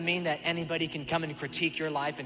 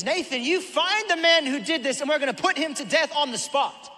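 high, loud furious decree; David raging, demanding death; outraged king shouting a sentence; irate king; 10 semitones higher, 9 dB louder than usual. Retold or imitated. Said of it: "he's just irate".

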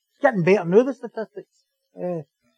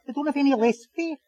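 normal talking voice

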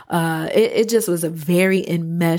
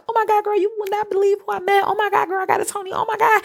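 put-on very high, breathless gushing girlfriend; gushing Broadway friend; 14 semitones higher, much faster than usual. Friend calling from Broadway, high excited gushing. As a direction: squeal it like a starstruck friend, fast. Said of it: "all of her calls are like".

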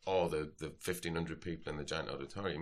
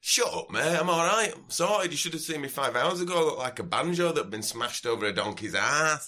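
hammed-up sozzled Yorkshire lad; drunk Yorkshireman; 8 semitones higher, 6 dB louder than usual. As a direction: drunk Yorkshire festival-goer demanding a wristband. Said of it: "determined slasher from Yorkshire".

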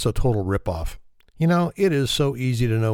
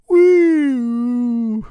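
high, loud whooping whale call; whale impersonation; 14 semitones higher, 6 dB louder than usual. Acted. Whale whooping to the bar crowd, high and loud. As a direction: let out a big drunken whale whoop. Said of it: "props for that whale impersonation".

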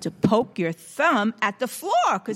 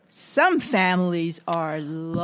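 put-on children's action rhyme. Shown as a demonstration. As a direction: draw out the rhyme for little children.

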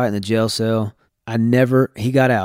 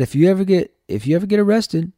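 dad's warning, voiced higher; imitating dad's warning; 8 semitones higher than usual. The father warning his son about getting arrested, lightly voiced and higher. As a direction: quote the dad as a stern warning, pitched higher. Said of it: "he said".

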